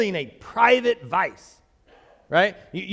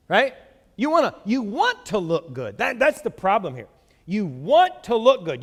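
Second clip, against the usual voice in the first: ordinary talk, nothing marked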